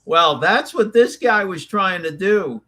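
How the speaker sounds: ordinary delivery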